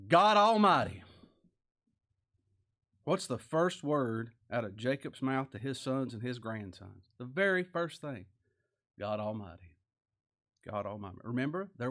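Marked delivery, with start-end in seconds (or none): 0.1-1.0 proclaimed loud. Acted. Reading Jacob's words aloud. 9.0-11.2 hushed reverence. Acted. Repeating the phrase, hushed.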